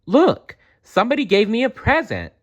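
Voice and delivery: squealing over a gift; excited girl. A narrator's reading. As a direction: gush like a thrilled little girl. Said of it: "she was very excited".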